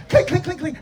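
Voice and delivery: high, loud imitated clinks; clinking bottle imitation. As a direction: mimic glass bottles knocking together. Imitates bottles knocked over.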